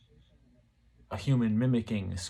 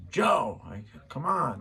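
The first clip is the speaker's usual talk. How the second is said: impatient call; 4 semitones higher, slower than usual. Retold or imitated. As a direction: call the name again, losing patience. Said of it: "say it a little differently because I'm not answering".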